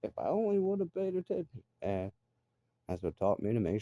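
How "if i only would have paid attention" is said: much higher, exasperated put-on voice; mock frustrated self. Retelling his own roadside regret, high exasperated voice. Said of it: "And I'm like".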